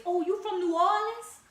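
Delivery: high, loud put-on voice of a surprised coworker; mimicking coworkers. Coworker hearing her accent, asking in a high mock voice. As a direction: squeal it like they just spotted the accent. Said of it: "The first thing they say is".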